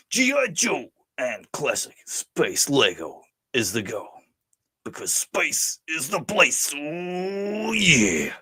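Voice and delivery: slow, drawn-out delivery climbing to a wail; hammed-up put-on voice. Host finishes a viewer's list in character, hammed-up. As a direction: stretch the words, then soar on the oh. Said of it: "we gotta do a voice for the Chris Mewa".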